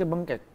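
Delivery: "bengkek" said with an e in place of the o of bengkok; slip of the tongue. Real, not acted. Guest misnames the crooked rib, a slip saying "bengkek". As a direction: stumble on the word, ending "bengkek" with -kek. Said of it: "Bengkok, bengkok".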